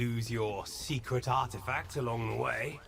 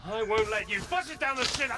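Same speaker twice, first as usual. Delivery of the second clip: higher, louder furious rant; angry shout; 7 semitones higher, 4 dB louder than usual. Acted. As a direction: rage at him. Angry outburst continues.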